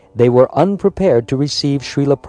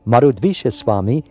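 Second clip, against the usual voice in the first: ordinary delivery, nothing marked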